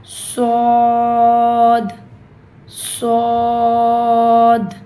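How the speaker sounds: صاد full-mouthed and heavy, held long; heavy saad. Shown as a demonstration. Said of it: "بہت موٹا، بھاری پڑھنا ہے". (صاد موٹا، بھاری اور لمبا)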